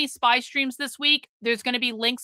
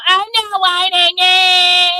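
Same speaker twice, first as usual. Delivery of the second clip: higher, louder put-on whine; mocking whiny voice; 6 semitones higher, 8 dB louder, slower than usual. Streamer mocks the blocked chatter denying he whines. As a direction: sneer it like a sulky kid. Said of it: "And then they go".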